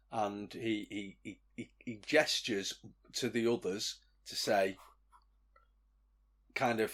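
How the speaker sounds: ordinary delivery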